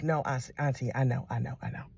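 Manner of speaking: soft, low, mumbled reassurance; a nephew backing down quietly; soft sheepish "I know"; sheepish mumble. Retold or imitated.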